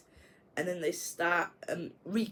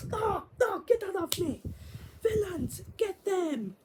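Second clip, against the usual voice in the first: much higher, panicked squeal; squealing villain. Retold or imitated. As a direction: squeak it out like a cartoon baddie caught in a web. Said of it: "He's like".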